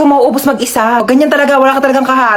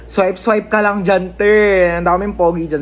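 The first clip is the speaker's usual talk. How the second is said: normal talking voice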